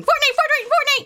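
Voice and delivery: put-on screaming ghost boy. Ghost boy wakes her up, high excited chant. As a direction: shriek it like a possessed child. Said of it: "boy in a Fortnite shirt screaming".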